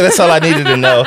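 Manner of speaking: loud laughing echo of the line; laughing repeat. Retold or imitated. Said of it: "He said".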